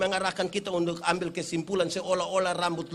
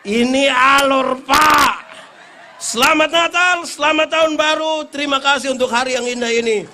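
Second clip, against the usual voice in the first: preaching at full force; 7 semitones higher, 13 dB louder than usual. Real, not acted. Preacher blessing the congregation with a loud festive shout. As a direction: close the sermon with a roaring blessing.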